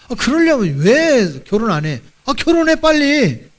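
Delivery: high-pitched playful exasperation; comic scolding, pitch jumping up; mock nagging. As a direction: pester him teasingly to get on with it. Mock nagging staff member to marry.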